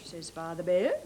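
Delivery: plain reading voice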